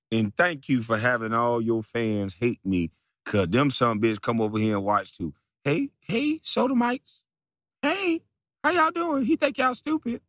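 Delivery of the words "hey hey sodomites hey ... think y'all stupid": much higher, squeaky mock greeting. Taunting the rival's fans, high mocking voice. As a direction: lampoon the rival in a high falsetto.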